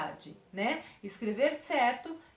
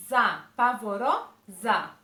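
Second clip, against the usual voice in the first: "pavorosa" slow and loud, last syllable split off; palavra separada; 2 semitones lower, 6 dB louder, slower than usual. Shown as a demonstration. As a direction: stretch "pavorosa" and pause before the final syllable. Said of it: "separadamente a palavra". ("pavorosa" alto e devagar, separando a última sílaba)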